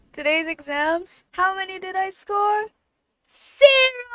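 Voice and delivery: high, loud, rising up to a shouted "Zero!"; mock game-show reveal. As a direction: announce your zero score like a quiz host.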